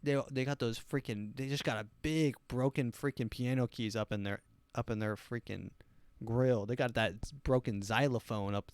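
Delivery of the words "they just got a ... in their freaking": ordinary talk, nothing marked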